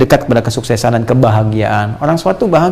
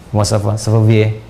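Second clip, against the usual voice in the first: light French imitation, a little lower; lightly imitated French accent; 4 semitones lower than usual. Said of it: "Kayak orang Prancis".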